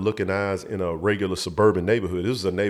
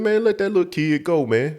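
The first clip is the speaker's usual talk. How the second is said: higher-voiced insistent retelling; retold demand; 9 semitones higher than usual. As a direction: imitate a man demanding, pitch raised high. Retold big man demanding release.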